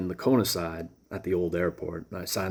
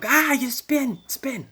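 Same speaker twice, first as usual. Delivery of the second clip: very high, loud sing-song taunt; high gloating voices; 5 dB louder, slower than usual. Retold or imitated. Head voices gloating at him, very high taunt. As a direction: gloat like a mocking spirit.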